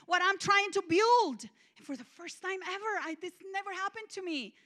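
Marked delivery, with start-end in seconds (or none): 0.0-1.5 shouting high and loud at God; loud angry shout. Retold or imitated. Raging at God in the shower, shouting high and loud. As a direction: yell it in a tantrum. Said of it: "I remember loud saying".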